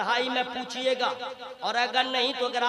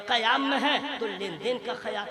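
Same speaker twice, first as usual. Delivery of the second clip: ordinary talk, nothing marked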